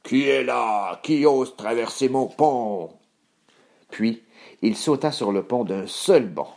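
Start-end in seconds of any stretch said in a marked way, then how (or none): 0.0-1.0 troll growl, less drawn out; menacing troll at a brisker clip; roaring troll. Acted. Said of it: "rugit aussitôt". 1.0-2.9 troll growl, less drawn out; menacing troll at a brisker clip; roaring troll. Acted. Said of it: "rugit aussitôt".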